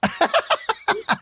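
a short high giggle; quick high laugh. Real, not acted. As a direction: laugh it off brightly. Reacting to the noise question.

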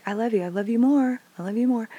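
lightly acted game of outdoing each other; playful game voice. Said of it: "we used to play this game".

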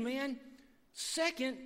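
ordinary talk, nothing marked

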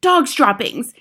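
high, loud yell. A narrator's reading. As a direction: yell out the discovery. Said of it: "she yelled".

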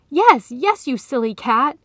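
high, loud, panicked exclamation; urgent, frightened shout; fearful cry. A narrator's reading. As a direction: cry it out in alarm. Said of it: "he cried in fear".

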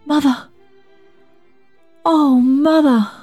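put-on high child's cry, drawn out at the end; soaring, emotional call to mother; overwhelmed cry. A narrator's reading. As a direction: cry out as an awestruck little boy. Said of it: "was all David cried".

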